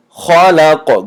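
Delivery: خلق with thick, rounded kha and qaf, "kholaqo"; heavy kha. Shown as a demonstration. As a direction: fill the mouth on خلق. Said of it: "حرف خا استعلا است". («خلق» бо хо ва қофи ғафс)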